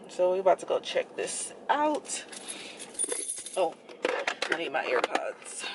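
muffled behind cloth mask; speaking masked; through a face mask. Real, not acted.

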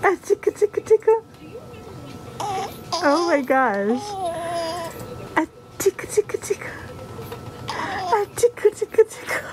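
high sing-song tickle chant; tickle-tickle up high and playful; giggly tickle attack. Acted. Tickling baby, high play voice.

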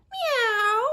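high, loud meow; cat meow. Acted. Teacher voicing the cat, high meow. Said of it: "said cat".

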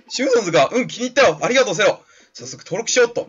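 put-on high, pleased young hero voice; game hero, delighted. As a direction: voice the hero happily taking up a new name. (高めの声で嬉しそうな主人公)

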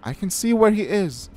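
high, loud cut-in; urgent interruption. A narrator's reading. Daughter on silo spotting brother, urgent interruption. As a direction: cut in with a shout. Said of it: "I interrupted".

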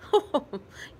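hearty laugh out loud; father laughing at his son's answer; father's laugh. A narrator's reading. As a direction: let out a big amused laugh. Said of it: "His father laughed out loud".

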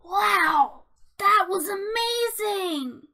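loud, awestruck exclamation; thrilled and loudly impressed; amazed. Acted. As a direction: burst out in excited admiration. Marvels at friend's rescue.

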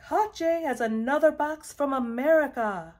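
high, loud holler. A narrator's reading. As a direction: call it out for the whole street to hear. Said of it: "he hollered".

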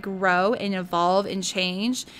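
ordinary talk, nothing marked

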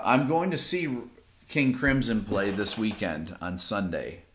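ordinary talk

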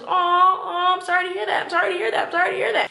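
high, cooing mock condolences; put-on sympathy. Woman recounting all she said on the date, put-on sympathy. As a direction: parrot polite sympathy on a loop.

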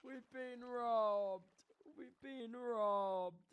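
high, very drawn-out lament; long, dismayed moan; despairing wail. Real, not acted. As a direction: wail it slowly over the loss. Discovers the chest was robbed.

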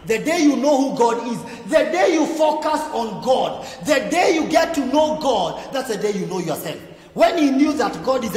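preaching at full force. Acted. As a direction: hammer each line home to the congregation. Preacher builds to a climax.

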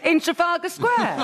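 feigned disbelief. Pretend.

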